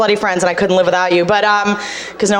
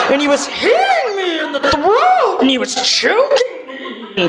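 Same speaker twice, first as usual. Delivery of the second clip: higher laughing telling; laughing through it; 5 semitones higher than usual. Pretend. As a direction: giggle through the story. Absurd fake story, laughing.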